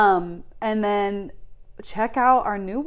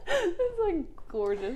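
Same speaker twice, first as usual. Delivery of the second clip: laughing; 9 semitones higher, slower than usual. Real, not acted. Laughing at lotus line.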